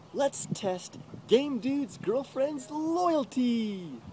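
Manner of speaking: high, slow scheming mock troll. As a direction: plot it slowly, like a sly troll. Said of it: "The troll's like".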